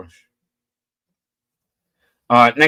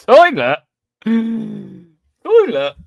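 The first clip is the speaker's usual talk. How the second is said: laughing disbelief; 7 semitones higher, 3 dB louder, much slower than usual. Real, not acted. Reading a daft guess, laughing.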